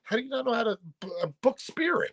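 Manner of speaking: high, fast incredulous Brooklyn rant; exasperated rant. Retold or imitated. Retelling his frustration with the uncles, in a high exasperated Brooklyn rant. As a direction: squeal it out in disbelief, Brooklyn style. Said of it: "I'm like".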